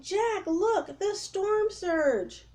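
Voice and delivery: high, very slow alarmed call; frightened scream. Acted. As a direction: point at the terror. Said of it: "Annie screamed".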